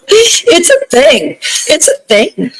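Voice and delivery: high laughing admission. Real, not acted.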